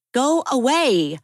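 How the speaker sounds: sharp high command to go away; firm shout. Acted. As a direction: drive him out of the doorway. Abby confronts the creditor.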